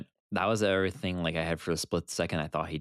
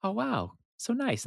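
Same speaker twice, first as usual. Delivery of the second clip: much higher sing-song "oh, wow, so nice"; mock calm; 13 semitones higher than usual. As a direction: play it naive before the hit. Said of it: "And it was like".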